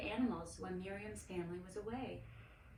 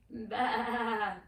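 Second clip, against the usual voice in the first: sheep grunt; 2 semitones higher, 6 dB louder than usual. A narrator's reading. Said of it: "Snowball grunted".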